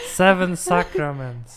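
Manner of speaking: laughing through the words. Real, not acted. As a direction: say it while laughing. Laughing correction between hosts.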